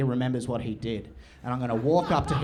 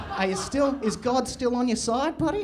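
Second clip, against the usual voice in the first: higher mock-chummy taunt; fake friendly; 8 semitones higher than usual. Taunting the bully about God, higher fake-sweet tone. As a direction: needle him sweetly. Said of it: "mock chummy taunt".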